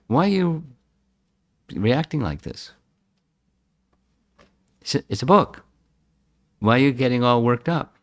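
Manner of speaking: retold talking-to to oneself, pitch jumping up; self-questioning. Questioning his own emotional reaction.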